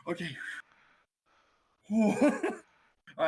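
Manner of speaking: talking through laughter, calming; laughing. Real, not acted. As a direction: try to calm down, giggling. Laughing at the rat voice.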